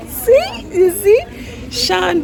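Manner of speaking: high, amused exclaiming; excited high voice. Real, not acted. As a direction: point it out with excited glee. Excited about toddler running.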